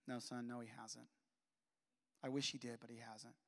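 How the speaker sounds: low, very soft retold mother; gentle refusal. Mother telling her son dad hasn't changed, gently. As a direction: let her sad answer sink to a hush.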